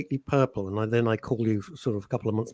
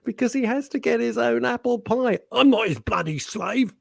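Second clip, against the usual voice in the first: mock moaning; 9 semitones higher than usual. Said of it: "Mike is moaning like hell".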